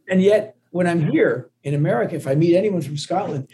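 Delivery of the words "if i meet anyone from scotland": ordinary talk, nothing marked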